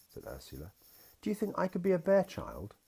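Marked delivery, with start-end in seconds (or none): 1.2-2.7 put-on high, eager child asking; a small girl's bright question; little girl voice. A narrator's reading. Daughter asks her daddy in a high, eager little voice.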